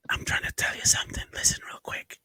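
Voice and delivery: fast urgent whisper. Pretend. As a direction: hush it out quickly. Whisper bit.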